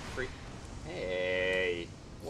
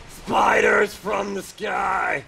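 slow, high, loud announcement; dramatic shout; 9 semitones higher, 12 dB louder, much slower than usual. Acted. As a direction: bellow it like a horror trailer. Dramatic reveal of spider boss.

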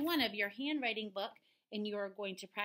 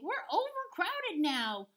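high, slow cartoon lament; Roly Pig; 9 semitones higher, 5 dB louder than usual. Pig complains of overcrowding, high slow lament. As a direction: moan it high and drawn out. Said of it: "lamented Roly Pig".

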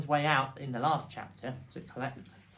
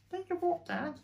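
put-on very high little-kid voice; high-pitched curious cub; small fox child; 3 dB louder than usual. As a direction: ask Dad like a puzzled child. Small fox asks father.

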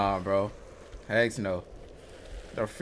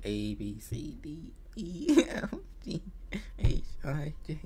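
whispering; 9 dB quieter than usual. Real, not acted. Counting letters to self.

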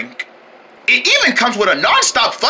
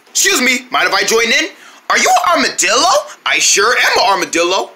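ordinary delivery

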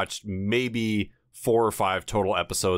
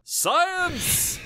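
high-pitched drawn-out yell; mock yell. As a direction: cry it out like a cheesy title-card shout. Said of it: "one of us would have to yell".

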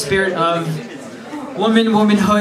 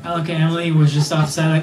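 ordinary talk, nothing marked

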